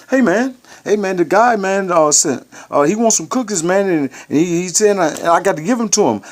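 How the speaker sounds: high, pleading mock voice of another inmate; squeaky wheedling begging for favors; imitating the guy. Inmate begging the kitchen captain for cookies, high mock voice. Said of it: "This is the, uh, conversation by guy".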